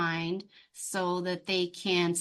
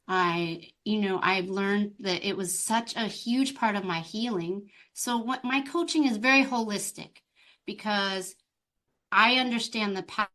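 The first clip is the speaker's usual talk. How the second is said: ordinary delivery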